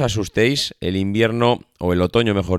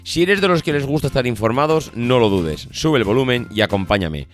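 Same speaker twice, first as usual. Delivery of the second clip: upbeat host intro, higher pitched; podcast intro; 4 semitones higher than usual. Acted. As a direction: welcome listeners like a radio announcer. Podcast intro over music.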